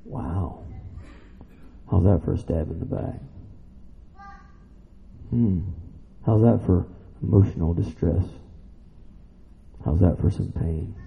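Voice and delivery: low, soft, sobered preaching; hushed gravity. Real, not acted. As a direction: let the hard part land quietly and gravely. Grave turn to betrayal.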